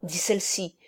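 ordinary delivery

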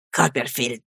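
low, soft naming; subdued address. A narrator's reading. Rival breaks a long silence, subdued address. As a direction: drop the voice low on the name.

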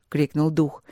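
ordinary delivery, nothing marked

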